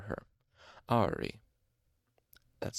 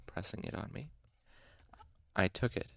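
usual speaking voice

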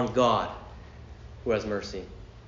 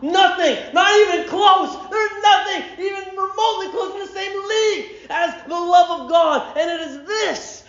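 loud, high shouted preaching; preaching at full force; 13 semitones higher, 4 dB louder than usual. Acted. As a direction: build to a ringing climax. Preaching peak on God's love.

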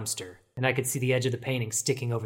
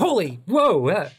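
a sudden loud jump of fright, pitch shooting up; startled; 5 semitones higher, 5 dB louder than usual. Acted. Detective startled by a stranger appearing behind her, loud yelp. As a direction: caught off guard, yelp it out.

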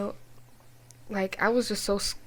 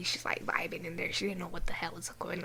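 whisper; 5 semitones lower, 12 dB quieter than usual. Real, not acted. Aside about sister.

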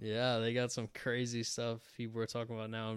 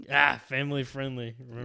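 loud teasing warning; 8 dB louder than usual. Real, not acted. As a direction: wag a finger with your voice. Teasing reminder to partner.

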